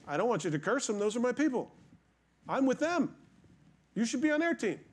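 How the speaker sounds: a lightly voiced God, climbing up; voicing God. God's voice in retold story.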